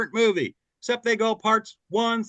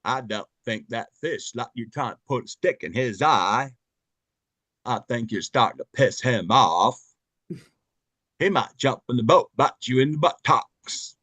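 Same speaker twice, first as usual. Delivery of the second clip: lower, simple Southern Gump voice; Forrest Gump impression; 7 semitones lower than usual. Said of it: "Forrest Gump".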